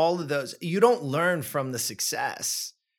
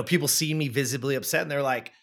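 usual speaking voice

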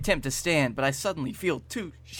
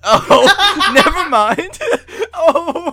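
high, loud, helpless laughter through the words; cracking up; 13 dB louder, much slower than usual. Real, not acted. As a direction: lose it laughing mid-take. Cracks up, abandons the take.

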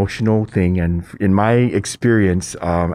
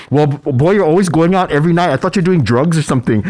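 lightly exasperated mother, pitched higher; exasperated mom; 9 semitones higher, 4 dB louder, faster than usual. Retold or imitated. Mom explaining her suspicion to son, mildly exasperated. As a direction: scold in a high, lively retelling.

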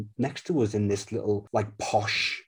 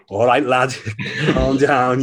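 louder, self-mocking Scouse pep talk; a half-laughing nudge to calm down, louder; telling himself to calm down; 3 semitones higher, 6 dB louder, slower than usual. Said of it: "you do have to go".

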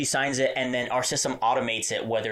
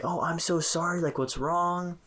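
higher-pitched, sympathetic apology; concerned; 7 semitones higher than usual. Retold or imitated. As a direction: gently voice a worried clerk's sympathy. Retelling own concern for crying customer.

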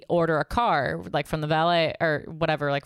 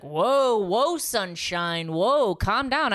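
slow, drawn-out mock soothing; patronising bartender; 4 semitones higher, much slower than usual. Bartender telling angry customer to settle, patronising. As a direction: stretch it out to placate her.

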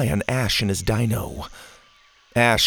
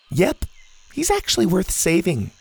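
casual; 8 semitones higher than usual. Acted. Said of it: "Zeta said casually".

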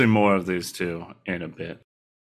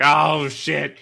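excited; 8 semitones higher, 5 dB louder, slower than usual. Retold or imitated. Voicing the boys cheering.

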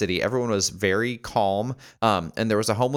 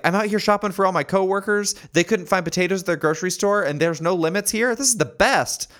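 much higher, thrilled; cheerful shopper; 11 semitones higher, 4 dB louder than usual. Retold or imitated. Shopper explaining to the narrator, higher and delighted. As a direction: gush about the potato haul. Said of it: "she was like".

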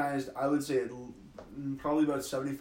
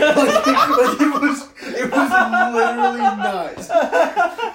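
words squeezed out through real laughter, much higher and louder; laughing; 11 semitones higher, 13 dB louder, much slower than usual. Real, not acted. Friend describing the pilot's English words in French, breaking into laughter. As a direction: crack up while trying to talk. Recalling absurd pilot, cracking up.

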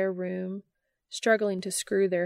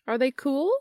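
higher, louder eager question; eager hopeful lift; 7 semitones higher, 4 dB louder than usual. Acted. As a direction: ask it full of hope. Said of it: "asked Charlie hopefully".

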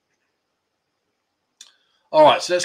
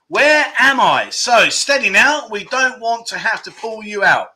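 slow, higher, louder clue read; quiz clue announcement; 7 semitones higher, 6 dB louder than usual. Pretend. Host to players with the first clue, slow quizmaster delivery. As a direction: announce it slowly, drawn-out and high.

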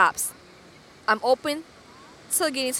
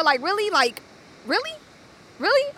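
incredulous; 8 semitones higher than usual. Retold or imitated. Her retold disbelief at his question.

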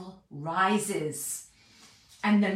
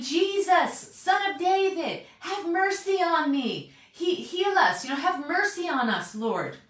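higher pleading call, slowing on the mercy line; crying out for mercy; 7 semitones higher than usual. Retold or imitated. Blind men calling after Jesus, pleading loudly. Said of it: "And they're crying out loud".

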